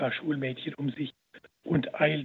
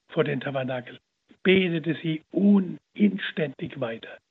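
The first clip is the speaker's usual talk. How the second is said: ordinary delivery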